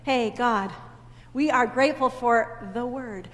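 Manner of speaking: usual speaking voice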